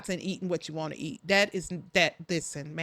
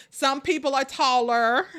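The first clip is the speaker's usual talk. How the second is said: ordinary talk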